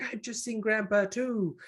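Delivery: mock panic in a high voice; high-pitched, acted alarm; freaking out. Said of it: "she's freaking out and she's just like".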